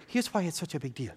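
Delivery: hushed imitation of a Wakandan accent.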